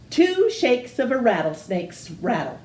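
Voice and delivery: plain reading voice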